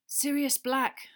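slow, tired naming; weary. A narrator's reading. Harry explaining to Wood, slow and weary. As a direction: drag the name out wearily. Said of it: "Harry said wearily".

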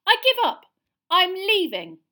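very high, loud outburst; furious shout. A narrator's reading. Girl quitting the class, shouting. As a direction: yell it as you storm out. Hermione storms off.

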